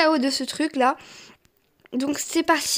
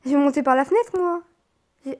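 ordinary talk, nothing marked

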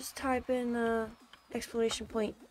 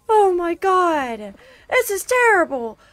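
distressed cry; 8 semitones higher, 9 dB louder than usual. Real, not acted. Distressed by the game map.

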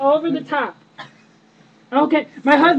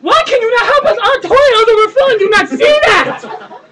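high, loud, breaking into laughter; laughing mock outrage; 6 semitones higher, 7 dB louder than usual. Wife yelling at the plumber, laughing outrage. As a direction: fake fury that keeps cracking up.

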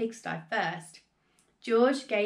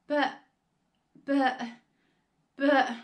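halting, higher, with long breaks; stammering; 4 semitones higher, 4 dB louder, slower than usual. A narrator's reading. George's mother shocked by the giant hen, halting stammer. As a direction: stammer the same word, stuck. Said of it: "stammered George's mother".

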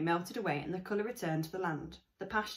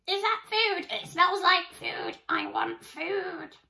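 much higher, louder, slowing excited dog voice; barking dog; 12 semitones higher, 8 dB louder, slower than usual. Acted. The dog chasing the kids with groceries, high barking. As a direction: yap it like an eager dog.

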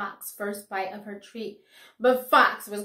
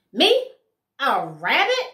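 indignant shout; 3 semitones higher, 10 dB louder than usual. A narrator's reading. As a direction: bellow it in offended disbelief. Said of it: "he shouted".

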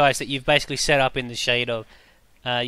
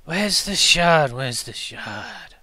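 echoing voice; 3 semitones higher, slower than usual. Acted. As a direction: let the repeat fade like an echo. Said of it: "you hear the voice again".

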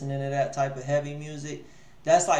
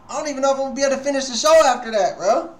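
rushed; 8 semitones higher, 5 dB louder, much faster than usual. Real, not acted. Overwhelmed by song.

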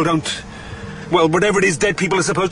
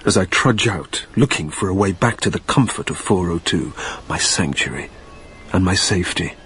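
low, hushed inner narration; story narration; 10 semitones lower than usual. A narrator's reading. Narrator trudging the airfield in a low brooding tone. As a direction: tell it low and brooding, to yourself.

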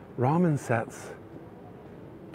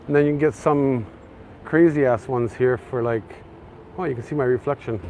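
ordinary talk, nothing marked

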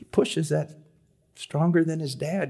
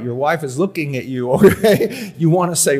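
usual speaking voice

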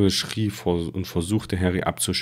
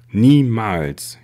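a sharp cry of refusal, louder; defiant shout; 4 semitones higher, 7 dB louder than usual. Acted. Harry refuses Voldemort, a defiant shout. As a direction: fling the word back at him.

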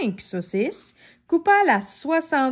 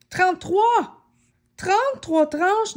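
big, high, loud reveal of the number; amazed exclaim; 7 semitones higher, 3 dB louder, slower than usual. A narrator's reading. Narrator revealing the huge count to the child, amazed. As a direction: shout out the count with delight.

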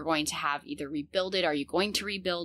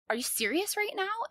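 pitched way up, retelling her shock; stunned disbelief. Retold or imitated. To her husband on the phone, shocked high disbelief. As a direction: ask it as if you can't believe the news. Hearing her house is on fire.

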